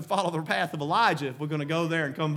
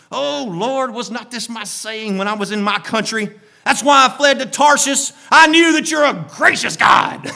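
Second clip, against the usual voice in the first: higher, louder grudging complaint; Jonah through gritted teeth; 7 semitones higher, 10 dB louder than usual. Acted. Jonah complaining to God through gritted teeth. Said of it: "his teeth were gritted".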